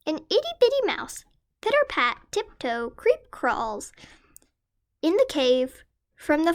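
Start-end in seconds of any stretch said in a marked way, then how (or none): none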